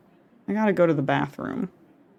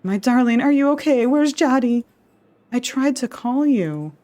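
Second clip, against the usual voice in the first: panicked calling; 5 semitones higher than usual. Acted. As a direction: call out in a rising panic. Character panicking at open door.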